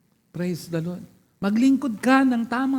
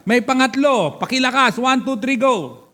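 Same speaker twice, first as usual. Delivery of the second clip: loud, higher-pitched cue to the crowd; rallying call; 4 semitones higher, 4 dB louder than usual. Real, not acted. Preacher cues congregation to read point three aloud, rallying call. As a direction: call out the count for everyone to read.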